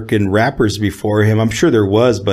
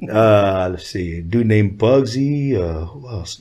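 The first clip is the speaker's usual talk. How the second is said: ordinary talk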